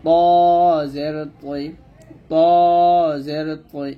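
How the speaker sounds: তৈ thick and heavy; full, heavy ত; emphatic taa demonstration. Shown as a demonstration. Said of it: "আর এটা হবে ত মোটা করে".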